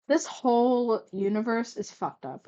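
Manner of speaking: usual speaking voice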